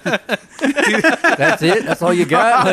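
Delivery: loud, high incredulous yell; mock outraged yell. Friend shrugs off his alcohol poisoning, so he yells back in disbelief. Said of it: "I was like".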